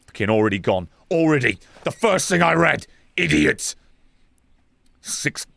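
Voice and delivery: normal talking voice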